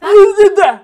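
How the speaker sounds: loud incredulous parroting; mock echo shout. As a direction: throw the line back loudly in disbelief. Echoes guest's odd line.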